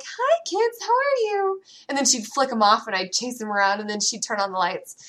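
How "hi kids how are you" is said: high, sing-song sweet mom greeting; sweet mommy voice. Retold or imitated. Mom switching back from monster, high cheery greeting. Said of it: "be like".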